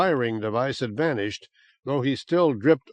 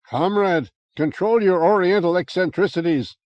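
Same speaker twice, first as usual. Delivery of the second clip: roar; 5 semitones higher, 4 dB louder than usual. Acted. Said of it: "El Toro roared at him".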